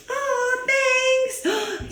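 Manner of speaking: a small cartoon voice touched by kindness; grateful grape. Grape thanks its friend.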